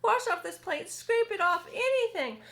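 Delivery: high, loud desperate begging; bawling centipede. Acted. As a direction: beg James frantically. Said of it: "bawled the centipede".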